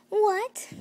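high loud shocked cry. Acted. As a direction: blurt it out in shock. Shocked at arrest.

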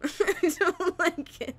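high, slow, nervous laughing whimper. Real, not acted. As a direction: whimper it through scared giggles.

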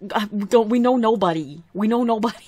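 loud, incredulous outburst; loud protest. Real, not acted. Player objecting that her Sim knows nobody, loud protest. Exclaims at game's mention of a friend.

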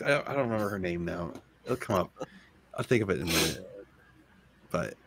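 rough, hoarse smoker's voice. Real, not acted. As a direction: talk with a hoarse throat. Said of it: "Dan, you sound like a smoker".

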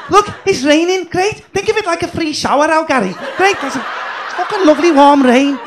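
nada tinggi, ceria berlebihan; happy Scouser. Retold or imitated. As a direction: pamerkan keceriaan konyol soal hujan. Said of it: "Scousers are just dead fucking happy and positive".

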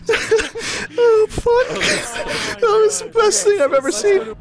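high squeal through real laughter; helpless laughing. Real, not acted. Player who saw the best thing ever, helpless laughing. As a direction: squeeze the words out while cracking up.

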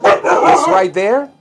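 leaning hard on right there; emphatic everyday phrasing. Retold or imitated. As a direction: stretch right there out the way people really say it. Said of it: "porque siempre dicen".

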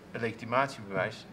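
usual speaking voice

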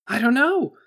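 high, loud, rattled technician; wide-eyed technician. A narrator's reading. Technician answering the boss, wide-eyed and rattled. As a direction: blurt it high and loud, at a loss. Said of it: "said the wide-eyed technician".

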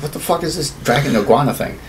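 ordinary talk, nothing marked

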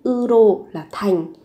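ordinary talk

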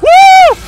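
very high, loud whoop of joy; triumphant whoop. Real, not acted. Player celebrating a win, triumphant whoop. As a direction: let out a big whoop. Victory cheer.

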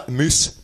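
"Moose" for mouse, with a Scottish oo; Scottish pronunciation. Acted. Faker saying her name Mouse, Scottish "Moose" vowel. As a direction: say "Moose" as a Scot says mouse. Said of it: "Or as I said".

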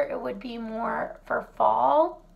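normal talking voice

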